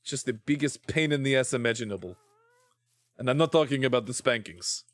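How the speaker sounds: mock threat in a Russian accent. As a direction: play a stern Russian scolder. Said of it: "Why am I still doing the Russian accent?".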